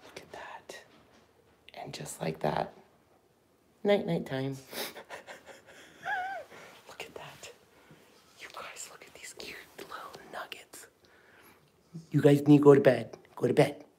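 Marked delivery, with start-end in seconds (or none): 0.0-0.8 whispering so the sleeping puppies stay asleep; hushed, breathy whisper; soft whisper over sleeping puppies. Real, not acted. 1.7-2.7 whispering so the sleeping puppies stay asleep; hushed, breathy whisper; soft whisper over sleeping puppies. Real, not acted. 3.8-10.9 whispering so the sleeping puppies stay asleep; hushed, breathy whisper; soft whisper over sleeping puppies. Real, not acted.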